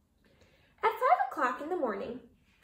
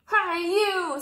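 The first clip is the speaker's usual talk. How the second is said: high, loud grouchy call; grouchy hail; 8 semitones higher, 8 dB louder than usual. A narrator's reading. Grouchy ladybug accosting the yellow jacket, high and loud. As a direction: call out to pick a fight.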